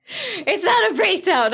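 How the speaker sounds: high, loud, slow protest; high loud denial. Real, not acted. Denying breakdown shrilly.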